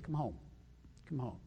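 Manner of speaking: father longs for a straying son, soft and low; tender call. Retold or imitated. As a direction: call him home gently, almost hushed. Father waiting calls son home.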